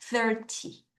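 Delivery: Thirty with strong THIR, weak ty; THIR-ty, light short ending; stress on the front. Shown as a demonstration. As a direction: hit THIR, let ty drop short. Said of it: "앞을 강하게 읽을게요".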